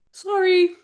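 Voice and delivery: high-pitched mock apology; high squeaky sorry. Real, not acted. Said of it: "mock apology to fans".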